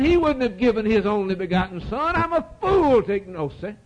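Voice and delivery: high, forceful pulpit cry; impassioned high-pitched preaching; fervent preaching. Real, not acted. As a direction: drive it home from the pulpit.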